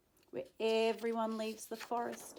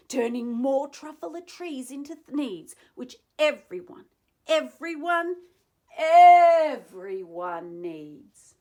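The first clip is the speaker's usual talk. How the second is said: climactic yell; 5 semitones higher, 14 dB louder than usual. Acted. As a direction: hammer home the last word. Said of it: "I yelled at the Lorax".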